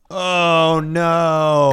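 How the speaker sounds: high, drawn-out "oh no"; mock dread. Co-host reacting to hotel danger, in drawn-out mock dread. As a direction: wail it in playful horror.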